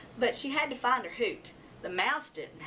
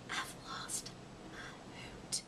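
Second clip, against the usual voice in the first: whisper; 15 dB quieter than usual. Acted. Owlet whispering her worry to the mouse. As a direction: whisper it like a lost secret. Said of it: "whispered Ola".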